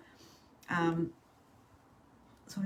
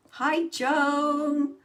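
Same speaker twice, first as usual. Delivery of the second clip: loud greeting; 7 semitones higher, 8 dB louder than usual. Real, not acted. Greeting viewer on live.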